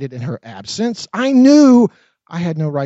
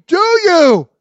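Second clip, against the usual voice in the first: much higher, loud shout; 12 semitones higher, 8 dB louder than usual. A narrator's reading. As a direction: yell the question. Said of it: "I shouted".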